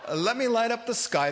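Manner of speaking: laughing. Real, not acted. Joking about lighting the sky.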